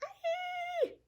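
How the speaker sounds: very high, thin squeal; shriek. A narrator's reading. Flap falling in the dark, terrified shriek. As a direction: shriek in terror. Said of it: "shrieked Flap".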